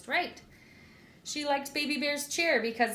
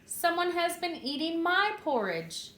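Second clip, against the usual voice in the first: lightly voiced mother bear, pitched higher; a middle-sized bear voice, a touch raised; Mama Bear's middle-sized voice; 8 semitones higher than usual. A narrator's reading. Narrator reading to children as Mama Bear, higher voice. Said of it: "She said in her middle-sized voice".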